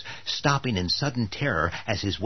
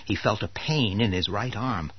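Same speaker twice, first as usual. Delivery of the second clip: ordinary talk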